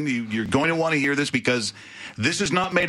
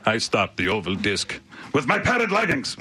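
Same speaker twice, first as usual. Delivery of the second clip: Kipper character voice. Pretend. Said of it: "he would talk like this".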